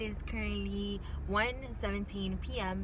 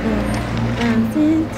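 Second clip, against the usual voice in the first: calling out; 3 semitones higher, 17 dB louder, slower than usual. Real, not acted. Calling out again.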